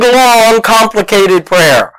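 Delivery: ordinary talk, nothing marked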